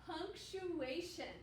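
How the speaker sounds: slow syllable by syllable. Shown as a demonstration. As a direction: say Punctuation slowly again, syllable by syllable. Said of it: "One more time".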